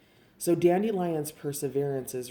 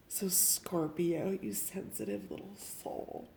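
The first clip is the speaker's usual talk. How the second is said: soft whisper; 4 semitones higher, 4 dB quieter than usual. Real, not acted.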